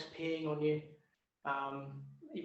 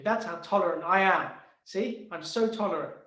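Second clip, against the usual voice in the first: sarcastic boast; 2 semitones higher, 12 dB louder than usual. Acted. Tree sarcastically claims tolerance.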